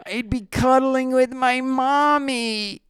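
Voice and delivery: put-on high, slow, whining man-child; whiny crybaby. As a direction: wail like a sulky kid who wants mommy. [name] regretting Halo, whiny.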